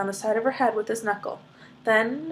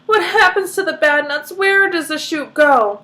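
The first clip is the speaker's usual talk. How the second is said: louder, higher frantic questions; panicked mother; 6 semitones higher, 5 dB louder than usual. Acted. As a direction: demand it in alarm. Panicked mother demanding answers.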